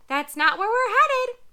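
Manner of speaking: high, loud squawky parrot. Acted. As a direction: chirp it out like an anxious parrot.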